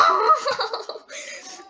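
high, loud laughing burst; burst of laughter. Real, not acted. Player falls after a failed jump, bursts out laughing. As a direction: laugh out at your own fail.

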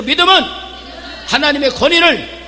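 loud call and response. Shown as a demonstration. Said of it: "큰 소리로".

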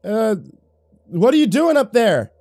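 loud calling up to a roof; shouting up. Acted. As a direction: yell up through the storm. Yelling at man on roof.

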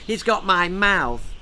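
put-on a boozy uncle claims the baby's eyes; spluttering drunk uncle. A narrator's reading. Uncle boasting to family, spluttering drunkenly. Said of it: "spluttered wine-sodden Uncle Igor".

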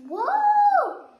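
high, loud startled cry with a laugh; startled whoa. Real, not acted. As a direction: yelp as your foot slips. Slipping again.

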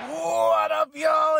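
pumped-up hello to the listeners; hyped greeting shout. Acted. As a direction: yell it out to open the show. Podcast intro greeting.

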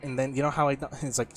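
ordinary talk, nothing marked

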